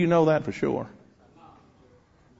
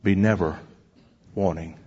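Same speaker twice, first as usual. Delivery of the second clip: hymn recitation; 3 semitones lower than usual. Retold or imitated. Preacher closing his story with the hymn, slow solemn recitation. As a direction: recite it measuredly, easing down to a close. Recites the hymn's third stanza.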